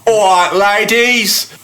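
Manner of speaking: loud, high, slow hammed-up Cockney call; mock Cockney. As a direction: bellow a hammed-up Cockney greeting at the ladies. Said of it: "that's not a Cockney accent".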